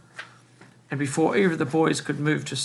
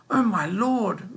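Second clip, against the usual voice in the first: slow, high, swooping cry of dismay; shocked cry; 7 semitones higher, 4 dB louder, slower than usual. A narrator's reading. Old woman seeing the dead cat, shocked drawn-out cry. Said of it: "Missus Griffin cried".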